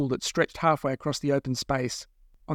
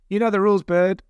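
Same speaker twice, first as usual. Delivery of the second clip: raised, higher call; 5 semitones higher, 5 dB louder than usual. Acted. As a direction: call out over the engine noise. Woman calling out over engine.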